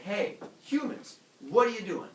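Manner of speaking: mock-scolding call, pitched high. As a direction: hail a group, then challenge them. Prometheus calls to the humans.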